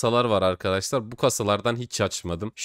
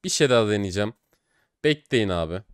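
usual speaking voice

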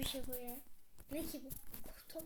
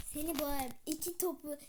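usual speaking voice

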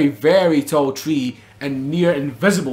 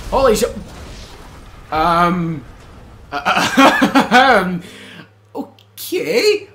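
normal talking voice

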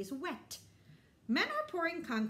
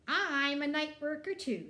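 put-on higher, louder proud little boy; Alex's voice; 5 semitones higher, 5 dB louder than usual. Alex telling Papa he works nights too, proud and loud. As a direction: say it like a proud kid. Alex speaks in read-aloud story.

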